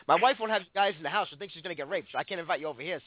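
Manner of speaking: ordinary delivery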